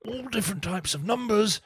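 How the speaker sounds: slow hushed nature documentary whisper; David Attenborough. Pretend. Host asks for Attenborough, slow whispered documentary narration. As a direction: narrate the tune like wildlife on film, slow. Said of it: "in the style of David Attenborough".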